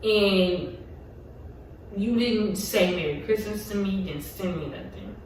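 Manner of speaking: quiet, choked-up voice, fighting tears; fading to almost nothing as the tears come; real hurt, voice dropping away on missing birthday gifts; holding back tears. Real, not acted. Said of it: "I'm trying not to get emotional".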